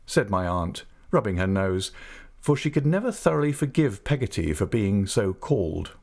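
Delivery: usual speaking voice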